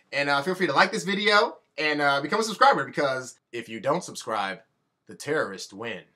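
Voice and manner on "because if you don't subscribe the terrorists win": deadpan fake trailer menace; mock ominous warning. Jokey subscribe plea.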